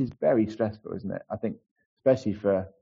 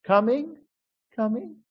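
reverberating echo; 11 semitones higher than usual. Acted. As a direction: imitate your call dying down a corridor. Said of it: "resonates down the corridor and reverberates".

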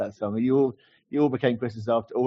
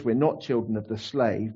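ordinary talk, nothing marked

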